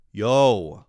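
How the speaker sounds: loud yo. Shown as a demonstration. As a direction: call out yo. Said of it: "yo repeated louder".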